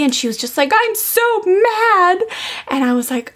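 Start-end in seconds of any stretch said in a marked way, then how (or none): 0.7-2.2 put-on high, slow little-girl voice, pouting; little daughter's voice. Daughter tells her mom she's mad, in a high, slow child voice. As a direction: imitate your small daughter drawing out her anger.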